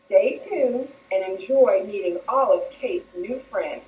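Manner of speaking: ordinary talk, nothing marked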